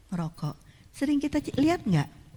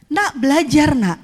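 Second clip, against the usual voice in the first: higher, louder call to study; 7 semitones higher, 8 dB louder than usual. Shown as a demonstration. Parent calling the child to study, louder and brighter. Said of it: "Sebaiknya adalah".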